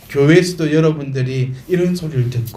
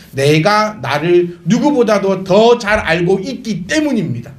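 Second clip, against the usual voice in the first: ordinary talk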